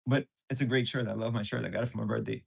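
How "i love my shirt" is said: usual speaking voice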